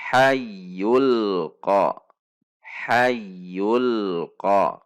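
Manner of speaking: "Hayyul ko" recited with held y and l flowing into ko; joined without pause. Shown as a demonstration. Teacher drills lam to qaf, no pause between. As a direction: link "Hayyul" to "ko" without any break. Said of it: "tidak ada jeda".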